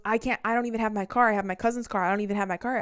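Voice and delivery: imitated loud, flustered excuse; lightly voiced ex pleading his case loudly; protesting ex. Ex protesting in acted quarrel.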